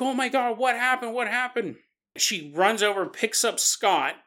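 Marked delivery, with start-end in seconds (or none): 0.0-1.8 freaking out. Retold or imitated. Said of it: "she starts freaking out".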